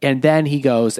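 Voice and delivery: normal talking voice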